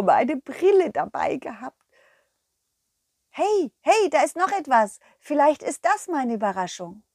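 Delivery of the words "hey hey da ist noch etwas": higher, louder eager calling; excited call. Acted. The dog telling the cow there is more to find, excited. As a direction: call out a new discovery.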